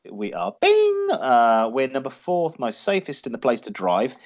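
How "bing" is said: loud, very high-pitched bing; ping it out like a quiz bell; imitated bell. Quizmaster announcing the answer, imitated high bell.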